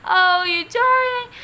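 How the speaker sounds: high, loud cry of delight. A narrator's reading. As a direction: gush over the tortoise. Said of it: "she cried".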